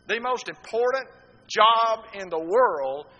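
loud, high preached line that slows at the end; a preacher's ringing declaration; preaching climax. Acted. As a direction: drive home the main point.

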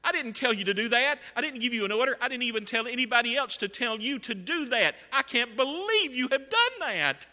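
high, loud indignant rebuke; acted-out appalled scolding; David, displeased. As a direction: disown the deed, outraged, building to the end. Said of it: "he does not respond in a favorable manner".

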